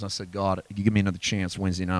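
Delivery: ordinary talk, nothing marked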